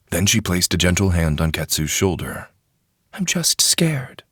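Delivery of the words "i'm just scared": slow, frightened whisper, high; scared whisper. A narrator's reading. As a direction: confess the fear under her breath. Frightened Wheeler confides.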